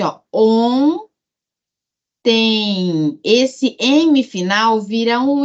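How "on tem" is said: "on tem" com vogais nasais alongadas; sílabas separadas. Shown as a demonstration. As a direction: diga "on", pausa longa, depois "tem" esticado. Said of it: "como se eu tivesse escrito assim". ("on... tem" devagar, destacando os sons nasais)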